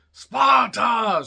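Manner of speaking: high, loud drawn-out shout; mock battle cry. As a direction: bellow it like a film war cry. Film battle cry quoted as joke.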